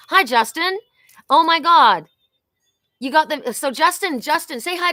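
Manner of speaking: loud, high, thrilled hello; light up at a friend arriving; excited greeting. Real, not acted. Reader greeting a viewer joining the live, loud and thrilled.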